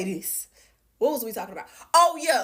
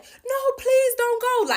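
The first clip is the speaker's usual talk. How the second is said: high, imitated sobbing beg; mock crying plea; 13 semitones higher, 3 dB louder, much slower than usual. Ex-boyfriend begging her not to leave, mock crying. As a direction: whine it like a man begging through tears. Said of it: "Like, crying his eyes out".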